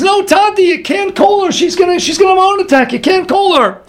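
high-pitched imitation of a pleading daughter; urgent pleading. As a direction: plead with father, voice pitched right up. Daughter begs father not to call.

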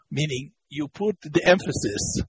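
ordinary talk, nothing marked

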